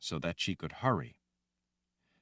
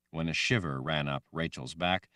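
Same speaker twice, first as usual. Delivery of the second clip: ordinary delivery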